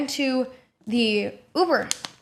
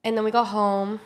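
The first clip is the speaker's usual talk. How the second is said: ordinary talk, nothing marked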